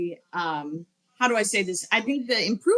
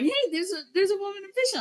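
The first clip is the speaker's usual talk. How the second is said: imitating an excited, high-pitched spotter; high voice, mimic someone eagerly pointing it out; excited discovery; 11 semitones higher, faster than usual. Retelling someone spotting a woman official.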